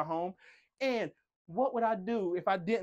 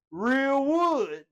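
higher and louder burst on real wood; excited emphasis; 7 semitones higher, 7 dB louder than usual. Real, not acted. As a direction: show it off, punch it out.

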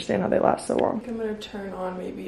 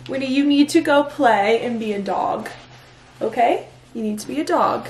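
sing-song dog-owner voice; calling to dog; 3 semitones higher, 6 dB louder than usual. Real, not acted. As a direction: cheerfully scold the dog to go play. Talking to her dog.

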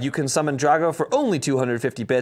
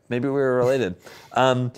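ordinary talk

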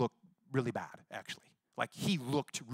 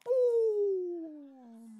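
high, drawn-out spooky boo; a cartoon ghost fleeing; mock devil "boo". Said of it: "that devil of cancer went".

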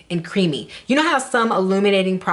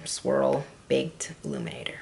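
whisper; 6 semitones lower, 9 dB quieter than usual. Acted. Product name whispered.

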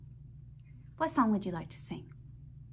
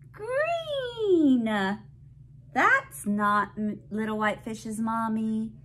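put-on reading to a toddler; 9 semitones higher, 4 dB louder than usual. Reading picture book to child.

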